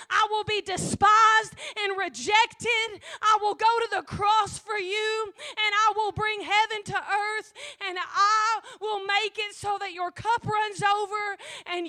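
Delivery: crying out, voice breaking, loud and high; weeping at the top of the sermon; tearful shouting. Real, not acted. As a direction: shout it through real tears. Preacher weeps while shouting God's promise.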